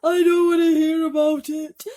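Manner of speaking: shrieking. A narrator's reading. Blind earthworm shrieking to the others in terror. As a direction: scream to shut it out. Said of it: "shrieked the poor blind earthworm".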